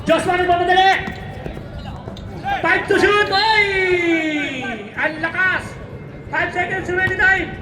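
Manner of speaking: excited countdown call of the closing seconds; urgent courtside announcer; live play-by-play. Real, not acted. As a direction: shout the shot, stretching the big words.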